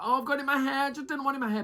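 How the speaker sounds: high-pitched mock fussing; overdramatic whine. Recapper imitating a slimed contestant, overdramatic whine. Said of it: "was doing a bit too much".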